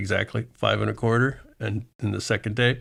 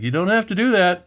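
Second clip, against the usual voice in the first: annoyed broker; 8 semitones higher, 4 dB louder than usual. Retold or imitated. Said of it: "He's like".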